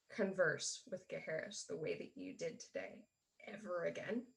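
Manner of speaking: quiet deadly threat; very serious. Acted. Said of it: "as she says very seriously".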